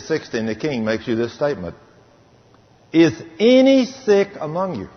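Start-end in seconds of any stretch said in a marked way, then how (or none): 2.9-4.9 scripture call. Acted. Quoting scripture to congregation.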